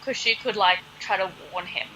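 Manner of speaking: usual speaking voice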